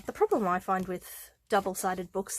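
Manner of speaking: usual speaking voice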